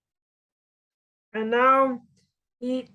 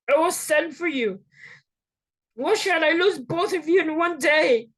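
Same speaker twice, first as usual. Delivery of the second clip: a mother begging her son to flee; urgent mother; 6 semitones higher than usual. Acted. Mother Rebekah warns Jacob.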